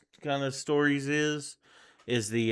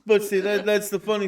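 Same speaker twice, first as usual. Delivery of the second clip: high laughing voice; 7 semitones higher than usual. Real, not acted. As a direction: laugh through the words. Laughing at joke.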